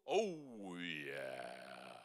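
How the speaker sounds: bright oh dropping low on yeah; low oh yeah demo. Shown as a demonstration. Coach demonstrating vocal fold zip, sliding low. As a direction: slide down to the lowest sound.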